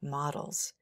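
usual speaking voice

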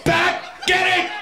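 high shrieking punchline with a laugh; squealed punchline. Pretend.

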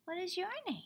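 ordinary delivery, nothing marked

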